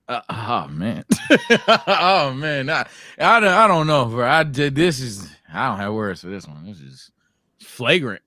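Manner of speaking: ordinary talk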